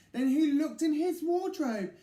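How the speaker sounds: high, loud, shaky stutter; stammering fright. A narrator's reading. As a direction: stumble over very in fear. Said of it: "feeling very frightened".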